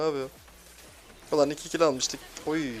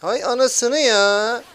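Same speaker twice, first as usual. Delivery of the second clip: "Hay anasını ya" yelled very slow, much higher and louder; real angry outburst at the game; furious shout; 8 semitones higher, 11 dB louder, much slower than usual. Real, not acted. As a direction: bellow "anasını" with a long rising cry.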